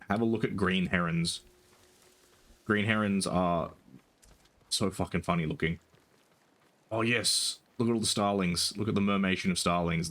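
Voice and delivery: ordinary talk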